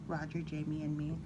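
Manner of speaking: usual speaking voice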